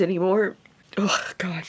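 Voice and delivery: tearful whisper, voice breaking off; fighting back tears, barely voiced; choked up. Real, not acted. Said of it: "I'm so emotional about this".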